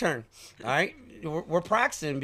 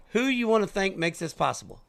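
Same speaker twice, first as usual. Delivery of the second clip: usual speaking voice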